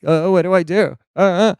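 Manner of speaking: high, dithering stammer; mock flustered. Stranded teen unsure what to do.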